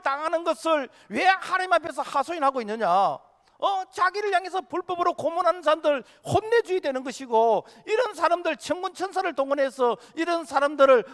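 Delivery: loud, high-pitched outraged questioning; indignant protest. Acted. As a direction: cry out in disbelief, easing only at the very end. Acting out doubters' protest at crucifixion. (격앙되어 높고 크게 따져 묻는 말투)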